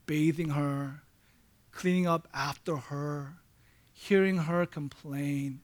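low and soft, with heavy pauses, imitating a worn-down husband; retold weeping. Preacher retelling a caregiver husband's radio call, subdued sorrow. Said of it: "he was crying and weeping".

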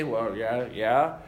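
low, reluctant mumbled agreement; half-hearted yes; grudging yes. Pretend. Imagined listener's reluctant reply.

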